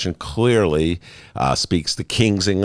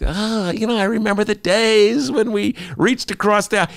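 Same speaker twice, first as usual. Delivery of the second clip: Joe Biden. Acted. Host mocking a politician's nostalgia for bipartisanship, in a high put-on voice. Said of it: "This is Joe Biden's pla-, problem".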